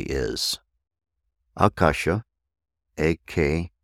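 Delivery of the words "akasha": Akasha with the first syllable stressed; Sanskrit pronunciation. Shown as a demonstration. Teacher shows the proper pronunciation of Akasha. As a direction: say Akasha as AH-kah-sha. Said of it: "proper pronunciation".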